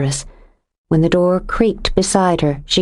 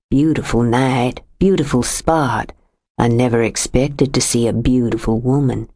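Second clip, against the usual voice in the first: low, too-sweet flattering man; syrupy stranger; 5 semitones lower than usual. A narrator's reading. Stranger flatters Jo in the dark, low syrupy sweetness. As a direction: pour it on like syrup, lower. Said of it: "It was like syrup, too sweet, too thick".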